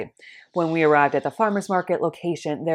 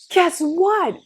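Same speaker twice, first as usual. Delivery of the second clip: high, loud gush of news; excited friend; 13 semitones higher, 4 dB louder than usual. Retold or imitated. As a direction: burst out with the news. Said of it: "my friend excitedly calls up".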